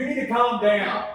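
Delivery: shouted, harsh order; barked calm down. Acted. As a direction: bark it as a command. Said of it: "bark at somebody and say, "Just calm down".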